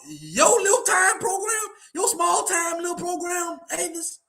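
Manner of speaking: high, drawn-out jeer at someone's program; mocking belittlement. Real, not acted. Host belittling a rival program to his critics, high mocking jeer.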